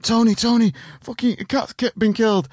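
high-pitched mock panic; panicking. Teenage boys running in to the pizza shop owner, panicking. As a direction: imitate a panicked teenager calling for help. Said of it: "You know, panicking".

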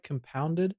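"Compounded" softly with a rising question; questioning pronunciation. Acted. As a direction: check the word "Compounded" tentatively. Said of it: "Am I saying that right?".

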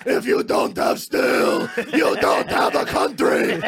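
slow, high, screamed Trump voice; screaming Trump tweet. Acted. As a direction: scream it like an all-caps tweet. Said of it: "screaming all of his tweets".

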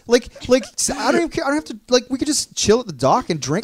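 high, gushing boat dreamer; giddy excitement. Retold or imitated. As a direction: pitch it like an overexcited kid. Retelling his own eager boat pitch.

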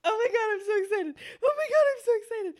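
high squeaky hammed-up excitement; mock squealing excitement. Friend mimics the birthday girl, mock squealing and high. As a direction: squeal it like a giddy fan.

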